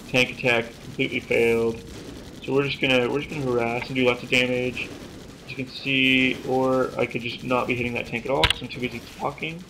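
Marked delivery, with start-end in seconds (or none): none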